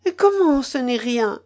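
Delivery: high, alarmed exclamation; understated frightened outcry; shuddering outcry. A narrator's reading. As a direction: cry out in dread. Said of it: "s'écria la jeune femme en frissonnant".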